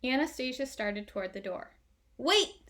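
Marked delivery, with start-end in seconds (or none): 2.2-2.7 sudden high, loud "Wait!"; loud call. A narrator's reading. Boy stops his sister leaving the room, loud and high. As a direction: blurt it out loud to stop them. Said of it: "Sam said in a loud voice".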